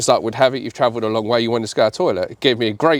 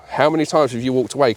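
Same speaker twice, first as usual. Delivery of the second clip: usual speaking voice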